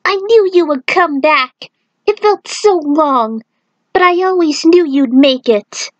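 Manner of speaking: put-on a child overjoyed his uncle is back; young Jin. A narrator's reading. Child Jin greets returning uncle.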